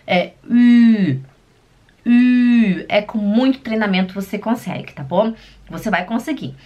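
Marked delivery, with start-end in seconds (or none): 0.1-2.8 loud, rounded "Ü", neither I nor U; Ü bem redondo. Shown as a demonstration. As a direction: hammer the "Ü" sound, lips pursed. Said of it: "não é I ou U". ("Ü" alto e arredondado, nem I nem U)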